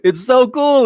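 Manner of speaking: high, loud, delighted; excited gush. Real, not acted. Gushing about the show.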